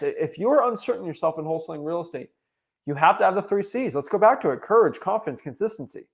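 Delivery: ordinary delivery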